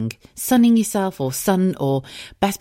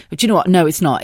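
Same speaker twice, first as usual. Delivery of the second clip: ordinary talk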